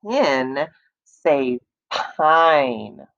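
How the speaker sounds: "pin say pine" drawn out very slowly; stretched out words. Shown as a demonstration. Teacher modeling the new word for kids: very slow. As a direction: stretch each word long. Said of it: "add a letter to make".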